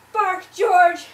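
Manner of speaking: very loud, forceful order; vet's loud command. A narrator's reading. The vet confidently orders George to bark, very loud. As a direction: boom out the order to the dog.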